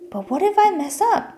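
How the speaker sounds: high, sudden anxious outburst; worried blurt. A narrator's reading. Toby fearing he'll fail the squirrel, high anxious blurt. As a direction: blurt it out in a panic. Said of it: "Toby blurted".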